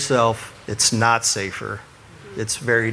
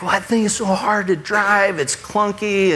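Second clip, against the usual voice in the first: a higher, lightly voiced wife complaining; wife's excuse; 8 semitones higher than usual. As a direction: mimic the wife brushing it off. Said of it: "she's like".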